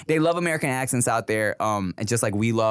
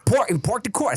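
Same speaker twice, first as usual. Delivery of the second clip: put-on Boston accent; 10 semitones higher than usual.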